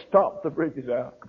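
ordinary talk, nothing marked

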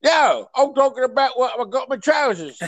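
high, exaggerated Cockney cab driver; thick hammed-up Cockney greeting; severe Cockney. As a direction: do an over-the-top chirpy London cabbie in Cockney. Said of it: "he was a severe Cockney".

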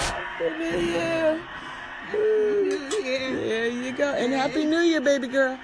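high laughing, tearful wishes; overjoyed, voice breaking with laughter; new year cheer. Real, not acted.

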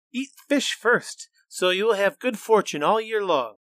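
put-on high, loud, urgent girl's voice; eager girl. Xingling loudly urges the monster to eat fish. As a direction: hurry to distract the beast.

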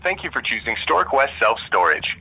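phone menu voice. Real, not acted. Automated phone menu recording.

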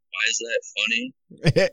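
high, slow puzzled question; baffled civilian. Retold or imitated. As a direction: mimic an outsider who doesn't get it. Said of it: "where a civilian be like".

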